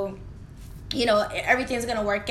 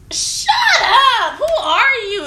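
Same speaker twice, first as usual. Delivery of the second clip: high, loud, laughing outburst; mock indignant yell; 11 semitones higher, 8 dB louder, slower than usual. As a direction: act out snapping at him, amused. Retold annoyed comeback to quizzing.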